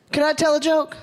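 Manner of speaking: calling out to the crowd. Shown as a demonstration. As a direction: shout it to get the room answering. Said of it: "we need crowd participation here".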